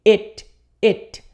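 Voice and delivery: It, it said short, like "et", never like eat; pronouncing it. Shown as a demonstration. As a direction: clip it short with an E sound. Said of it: "a pronúncia é com som de E". ("It" curto com som de E, não "eat")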